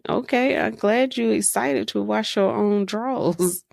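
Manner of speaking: ordinary delivery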